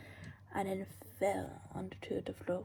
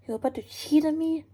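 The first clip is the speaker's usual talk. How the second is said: loud, high jealous accusation; angry; 8 semitones higher, 13 dB louder than usual. Acted. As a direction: shout it at the cheater. Jealous lover catching a kiss.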